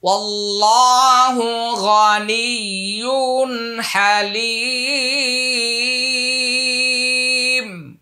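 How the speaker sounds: slow recited phrase with the final vowel held long; long drawn vowels. Shown as a demonstration. Teacher shows students where to lengthen, slow chant. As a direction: stretch the ending as in recitation. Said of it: "আমরা এখানে লম্বা করব".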